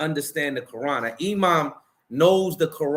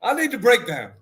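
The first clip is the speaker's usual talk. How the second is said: excited demand; 8 semitones higher, 4 dB louder than usual. Real, not acted. Demanding an explanation again.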